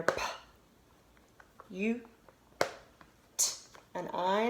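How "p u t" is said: "p-u-t" as three sounds, p, you, t; sounded out. Shown as a demonstration. Teacher helping children hear each sound, slowly segmented. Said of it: "So I hear".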